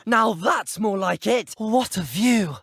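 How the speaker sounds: high, slow, thrilled exclamation; exhilarated. Acted. Game hero marvelling at the view, exhilarated. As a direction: call it out, elated by the view.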